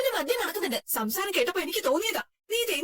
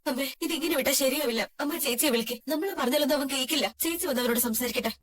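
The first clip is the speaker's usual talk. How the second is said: ordinary delivery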